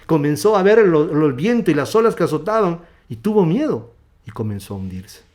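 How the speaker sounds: loud, high, driving sermon voice; preaching at full force. Real, not acted. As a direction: preach the story with mounting fervour.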